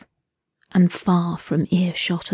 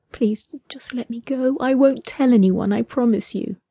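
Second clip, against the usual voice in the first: higher, softer, desperate plea; frightened pleading; 5 semitones higher, 4 dB quieter than usual. A narrator's reading. Captive woman begging to be released, frightened. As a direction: beg to be let go.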